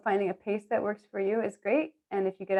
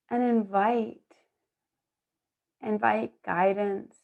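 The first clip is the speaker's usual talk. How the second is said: guided meditation; slower than usual. Acted. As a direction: guide the breath gently, unhurried. Kundalini cueing breath and movement.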